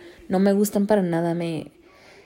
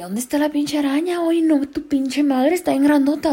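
shocked; 8 semitones higher, 5 dB louder than usual. Retold or imitated. Cousin sees huge spider.

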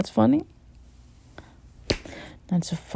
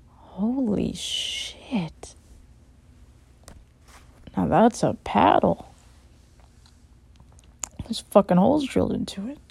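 ordinary delivery

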